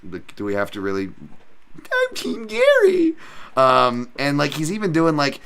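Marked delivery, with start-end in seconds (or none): 1.8-3.1 very high, drawn-out squeal; mock fan voice. Streamer mocking Ian Gary fans, high slow squeal. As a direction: squeal it slowly in put-on falsetto.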